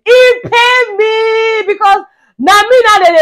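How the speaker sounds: high, loud, drawn-out complaint; theatrically pained, slow and high; aggrieved wail. Real, not acted. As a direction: stretch out the hurt in a dramatic cry. Recalls being called a crowd, aggrieved.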